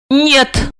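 flat-out firm no; decisive. A narrator's reading. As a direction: cut him off. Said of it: "решительно отрезала она".

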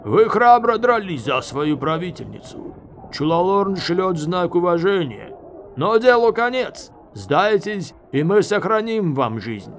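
loud, high formal proclamation; shouted surrender demand. A narrator's reading. Enemy officer offering terms through the doors, shouting. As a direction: call terms out to the besieged. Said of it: "раздался голос с сильным канадским акцентом".

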